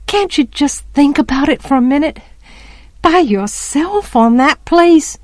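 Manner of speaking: put-on high, pleading child's voice. A narrator's reading. Child begging Jem to think about it, pleading. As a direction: plead with pitch held well up. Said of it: "I pleaded".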